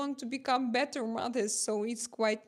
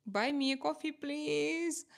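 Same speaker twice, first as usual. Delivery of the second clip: playful pleading; 5 semitones higher than usual. Real, not acted. Streamer asks a millionaire viewer for coffee, playful pleading. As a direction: wheedle sweetly, stretching the last word.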